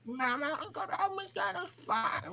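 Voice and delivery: hammed-up, very high kid's bawling; crying child. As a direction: squeal it like a mock toddler tantrum. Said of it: "that was me in the back of the truck".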